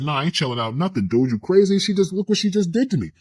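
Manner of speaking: man shouting at [name], refusing to calm down, furious; angry yelling. Retold or imitated. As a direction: yell back in disbelief. Heated argument with [name].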